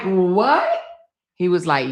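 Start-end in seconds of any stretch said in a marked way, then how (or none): 0.0-0.8 shocked what. Retold or imitated. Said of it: "I'm like".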